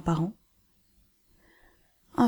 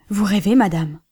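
louder, a sharp protesting cry; exclaiming; 5 dB louder than usual. A narrator's reading. Husband protests his wife's order, crying out louder. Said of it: "s'écria-t-il".

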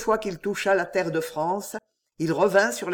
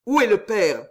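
rough, raspy cry, higher and louder; hoarse demand; 4 semitones higher, 5 dB louder than usual. A narrator's reading. Armand demanding the dying woman's father, hoarse voice. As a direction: force it out hoarse and urgent. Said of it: "demanda-t-il d'une voix rauque".

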